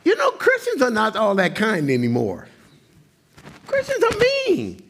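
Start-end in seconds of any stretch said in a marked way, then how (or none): 3.7-4.8 exasperated cry. Real, not acted. Exasperated outburst about mean Christians.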